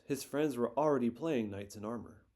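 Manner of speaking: ordinary delivery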